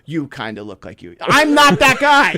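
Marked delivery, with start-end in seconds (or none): 1.2-2.4 high, slow laughing protest; laughing denial. Real, not acted. Joking back at his cohost, laughing and high.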